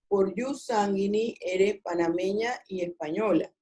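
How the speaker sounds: ordinary delivery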